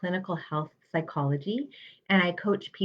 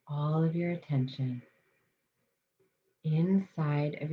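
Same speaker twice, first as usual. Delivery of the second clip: guided meditation. Acted. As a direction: ease listeners into calm, unhurried.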